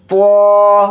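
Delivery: پو read thick and full; heavy full-mouth letter. Shown as a demonstration. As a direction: round and fill the mouth on پو. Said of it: "پو کو موٹا یعنی پر پڑھیں گے". (پو کو موٹا پڑھیں)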